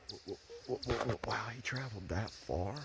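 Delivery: breathless whispered stammer; stunned whisper. Real, not acted. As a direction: whisper in awe, stuttering.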